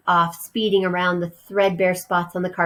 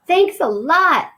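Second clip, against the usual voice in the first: eager mouse voice; 10 semitones higher, 5 dB louder, slower than usual. Acted. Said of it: "managed to say with his mouth watering".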